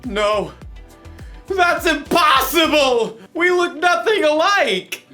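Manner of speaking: high, loud, slow disbelieving shout; hero's shocked cry. Pretend. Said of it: "pretend to be the villain".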